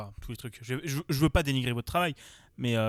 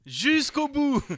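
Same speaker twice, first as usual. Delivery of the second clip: loud, high exclaiming, hammed-up disbelief; excited exclamation; 13 semitones higher, 6 dB louder, much slower than usual. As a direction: shout it in amused disbelief. Repeating the joke louder.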